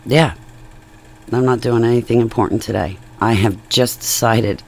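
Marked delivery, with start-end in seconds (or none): none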